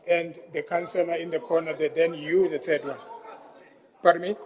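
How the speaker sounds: normal talking voice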